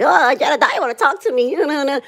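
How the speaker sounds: high-pitched mock-starstruck squeal of words; exaggerated high voice mocking a groupie; mocking fangirl gushing. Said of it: "I'm not a dick rider like y'all".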